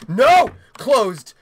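alarmed loud "no" and order; sudden loud scolding; shouted command. Real, not acted. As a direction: bark it sharply at a misbehaving creature. Ordering a creature to close.

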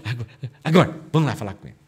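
loud hurried urging; last-second scramble, push them on; urgent rush. Acted. Men urging, time running out.

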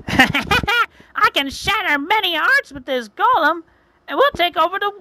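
hammed-up high-pitched witch threat; gloating witch. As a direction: gloat shrilly over your conquest.

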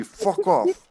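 very high-pitched squawk; mock squeaky outburst; Alyssa impression. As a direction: put on the most high-pitched voice. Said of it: "with the most high-pitched voice".

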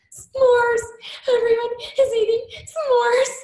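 high, loud, tearful wail through sobs; retelling her sobbing to her coach about the s'mores. Retold or imitated. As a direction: cry it out like a heartbroken kid over s'mores. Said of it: "I was crying, sobbing".

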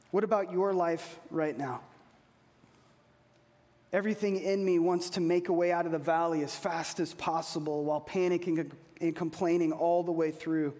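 ordinary delivery

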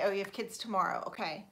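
normal talking voice

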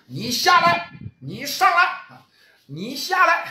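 high, taunting call-down; mock challenge. Monkey King taunting rival, high mocking challenge. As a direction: goad him to come down and fight. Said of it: "叫板".

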